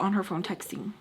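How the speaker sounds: usual speaking voice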